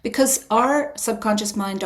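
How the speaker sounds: ordinary talk, nothing marked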